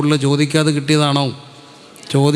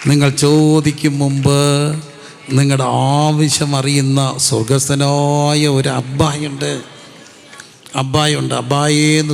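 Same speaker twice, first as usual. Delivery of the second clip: preaching climax; slower than usual. Acted. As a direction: proclaim it slowly to the whole hall.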